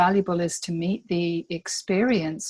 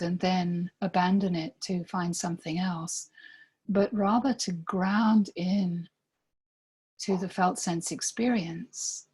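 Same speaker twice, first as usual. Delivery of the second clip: guided meditation. Real, not acted. Guide inviting listeners to breathe into themselves, calm meditation voice. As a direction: lead them gently inward, leaving space between phrases.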